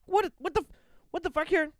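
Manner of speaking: high-pitched, alarmed interruption; shocked. Real, not acted. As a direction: cut in with rising disbelief. Shocked interruption of played video.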